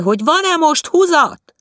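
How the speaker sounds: calling loudly down from the roof, high and loud; chimney sweep calling down from the rooftop, loud; shouting down. A narrator's reading. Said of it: "kiabált le Báthori úr".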